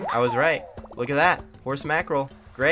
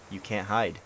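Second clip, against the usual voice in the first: normal talking voice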